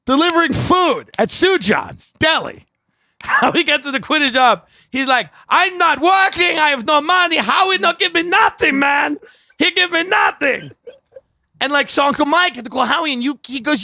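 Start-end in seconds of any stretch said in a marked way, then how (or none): 5.5-10.7 mock Spanish accent. Eddie complaining about no pay, high mock Spanish accent. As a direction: play the crying Eddie, much higher. Crying Spanish guy voiced.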